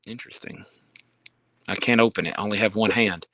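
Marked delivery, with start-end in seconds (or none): 0.1-0.6 whispered. Real, not acted. Quiet remark on a book.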